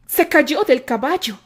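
Terry explains to Isaac, loud and shaken. A narrator's reading.

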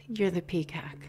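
soft whispered praise; quiet admiring whisper; hushed affirmation. Real, not acted. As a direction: whisper it like a treasured secret. Affirming another woman's success.

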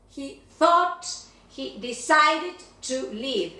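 "decided" said slowly, every syllable clear. Shown as a demonstration. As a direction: model "he decided to leave" carefully. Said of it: "pronunciación de decided".

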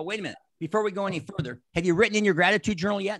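firing it back before they go on; quick challenge. Retold or imitated. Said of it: "And I go".

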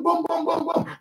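quick choppy demo of blunt talk; fast blunt talk. Shown as a demonstration. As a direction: rattle it off bluntly like a fast talker. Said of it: "Look at the way I talk".

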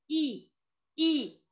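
a short high إي said twice; short i sound. Shown as a demonstration. Teacher models the kasra sound: short high إي. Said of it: "ينطق".

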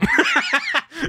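high bright laugh; burst of laughter. Real, not acted. Streamer laughs at a viewer's question, real laugh.

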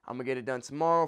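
low, soft mock excuse, rushed; lazy excuse. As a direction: mumble the excuse like a procrastinator. Mocking procrastinator excuses.

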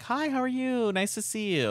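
forced politeness to a disliked relative; fake polite greeting. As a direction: put on a bright phony hello. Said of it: "I was like".

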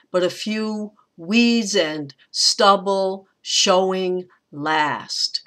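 slow scansion, hitting FEW, WEEDS, STUB, SHOW; anapest into trochees. Shown as a demonstration. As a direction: separate the feet, stress "weeds", "stubble", "showing", then "last" alone. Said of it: "the trochee, like going".

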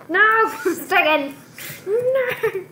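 high, loud no; anguished yell. Real, not acted. Gamer watching teammate Stegen die, high anguished yell. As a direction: wail it as your teammate dies.

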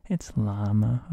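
"llama" firmly with a sounded L; hard L pronunciation. Shown as a demonstration. Teacher insists everyone else was wrong, "llama" with English L. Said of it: "everybody was saying it wrong except me".

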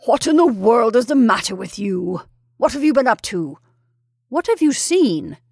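put-on loud, exasperated demands to a child; irritated questioning. A narrator's reading. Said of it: "said the irritated Colonel".

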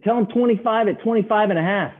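high mock trader feeding a line; imitated urgent instruction. Traders telling the newcomer what to quote, urgent mock instruction.

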